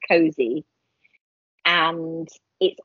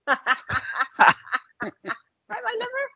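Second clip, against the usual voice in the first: Gloucestershire accent. Shown as a demonstration. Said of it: "it's kind of that Gloucestershire accent".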